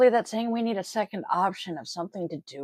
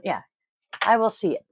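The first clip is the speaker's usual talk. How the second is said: ordinary talk, nothing marked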